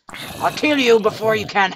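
loud taunt that cracks at the top; voice cracking. Real, not acted. Gamer taunting a zombie, voice cracking loud. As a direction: threaten the zombie, voice breaking. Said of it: "My voice is cracking".

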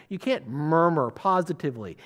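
ordinary talk

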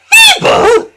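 very high indignant shriek; spluttering cross. Acted. Daisy insulted by the workman, spluttering crossly. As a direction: splutter the word in outrage. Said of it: "spluttered Daisy crossly".

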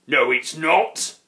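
loud, slow troll grump; grumpy troll. A narrator's reading. Grunt contradicts the friendly postman, grumpy and slow. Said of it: "grumped the Grunt".

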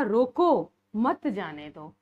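रोको set apart, then मत जाने दो; pause after रोको. Shown as a demonstration. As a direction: break after रोको to contrast the meaning. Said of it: "जबकि यहाँ था".

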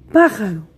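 pájaro, with PA hit hardest and the rest dropping away; stressed first syllable. Shown as a demonstration. Teacher gives pupils a word to sound out, stressed first syllable. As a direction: lean on the opening pá of pájaro. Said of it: "la sílaba que suena con mayor fuerza".

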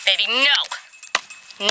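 slow, stretched warning to the dog; drawn-out warning. Real, not acted. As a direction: drag out each word as a warning.

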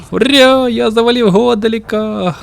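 high, loud victory cheer; triumphant cheer. Real, not acted. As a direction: celebrate the boss kill. Boss finally defeated.

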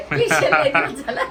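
loud, slowed by laughter; laughing. Real, not acted. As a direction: talk through a laugh. Laughing while telling of special dish. (हसत हसत)

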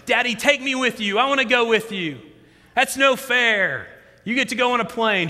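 put-on high-pitched, whiny little-kid protest; pleading child. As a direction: imitate a daughter begging to come along. Daughter begging, voiced by father.

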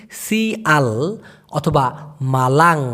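শিয়াল অথবা মালাং said slowly, each syllable clear; careful slow pronunciation. Shown as a demonstration. Teacher repeating শিয়াল অথবা মালাং slowly for students. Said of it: "আমি আবার উচ্চারণ করতেছি".

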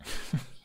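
quiet breathy chuckle; a short low laugh to himself; soft chuckle. A narrator's reading. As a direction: Hiccup chuckling at being called a softy. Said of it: "Hiccup chuckled".

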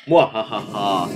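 slow evil cackle; hammed-up villain laugh. Vampire sleeps in coffin.

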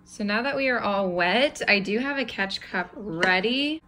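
normal talking voice

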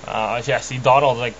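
ordinary talk, nothing marked

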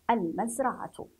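المزرعة ending in a voiced -tu; final ta sounded. Shown as a demonstration. Teacher repeats the option, المزرعة ending in -tu. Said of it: "ممكن أن أقول".

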